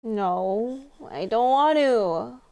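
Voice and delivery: put-on slurred, whiny tipsy girl; drunk voice. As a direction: play it drunk and stubborn. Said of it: "Ace does a really good drunk voice".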